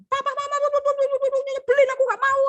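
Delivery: put-on high, loud childish whine; aggressive whiny child. Shown as a demonstration. Teacher demos an aggressive child whining at parents. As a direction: whine like a bratty kid. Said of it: "Nah, itu agresif".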